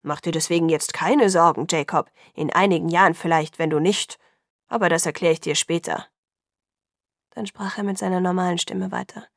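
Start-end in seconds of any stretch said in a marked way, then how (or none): 0.0-6.1 lower, firmer voice imitating his dad; mocking a stern father, a bit deeper; father's deep voice. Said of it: "imitierte die tiefe Stimme seines Vaters".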